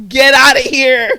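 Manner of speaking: hammed-up shout to shoo a kid away; mock yelling. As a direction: bellow a drawn-out playful dismissal. Repeats the shooing, escalated.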